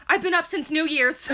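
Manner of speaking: high, loud imitation of an indignant friend; mock protest. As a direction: protest it loudly, on the edge of laughter. Mimics the friend's protest, laughing.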